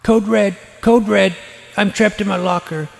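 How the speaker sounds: loud, high urgent radio plea; urgent call. Real, not acted. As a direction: yell for help from inside the locker. Trapped in locker, urgent radio call.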